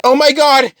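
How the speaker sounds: high, loud shriek of horror; horrified cry. Acted. Seeing the shark guy's disembodied head, horrified cry. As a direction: cry out at a grisly sight.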